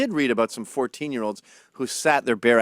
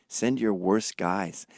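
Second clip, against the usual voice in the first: usual speaking voice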